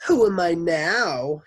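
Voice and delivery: put-on high, loud, drawn-out girl voice; child Leslie's voice. A narrator's reading. Teacher showing off a stolen voice, high and drawn out. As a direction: ask it slow in a high little-girl voice. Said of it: "It was Leslie's voice".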